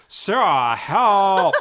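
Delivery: put-on loud, drawn-out Valley Girl plea; hammed-up Valley Girl cry. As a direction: whine for help like a Valley Girl. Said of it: "in her Valley Girl voice".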